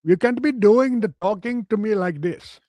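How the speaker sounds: mock Indian accent. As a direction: protest like a scandalised employee in a hammed-up Indian accent. Employee protesting in put-on accent.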